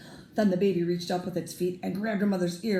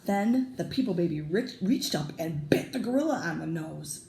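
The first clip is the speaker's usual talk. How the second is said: usual speaking voice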